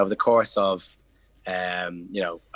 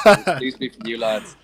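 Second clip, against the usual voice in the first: laughing; 9 dB louder than usual. Real, not acted. Laughing while recalling the bottle.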